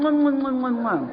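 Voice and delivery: a string of smacking air kisses, lightly mocking. Speaker mocking the stray sheep's goodbye, with smacking air kisses. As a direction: blow exaggerated kisses at the group.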